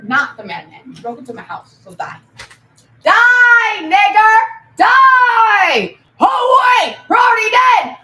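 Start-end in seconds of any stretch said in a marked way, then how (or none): 3.0-8.0 loud yelled taunts; shouting. Real, not acted. Taunting the game enemies with loud yells. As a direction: bellow the taunts.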